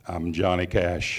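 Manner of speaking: very low, slow deep-voiced introduction. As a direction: drop right down into a soft, deep rumble. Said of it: "deep voice".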